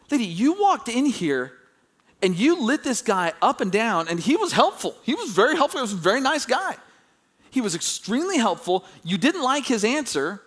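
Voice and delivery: high-pitched, exasperated mock rebuttal; indignant disbelief. Onlooker silently telling off the rude customer, indignant.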